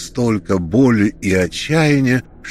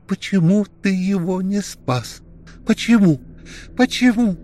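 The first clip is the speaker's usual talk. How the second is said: hysterical accusation; 10 semitones higher than usual. A narrator's reading. Alena accuses Kirill of not saving him, high tearful cries. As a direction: break down demanding why.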